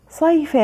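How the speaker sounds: صيف read high with an added -in ending; tanween kasr. Shown as a demonstration. Teacher reading out the word list, tanween kasr on صيف. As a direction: sound out صيف as "sayfin". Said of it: "أيضًا تنوين كسر".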